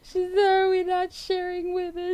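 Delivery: very high, helplessly amused voice; cracking up. Real, not acted. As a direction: struggle to get it out while cracking up. Cracking up reading the comment.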